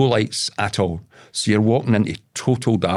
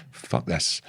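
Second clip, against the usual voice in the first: lower, softer grim curse; low muttered curse; 9 semitones lower, 8 dB quieter than usual. Retold or imitated. Deciding to bail out of the car, a low grim curse. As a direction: grit it out under the breath.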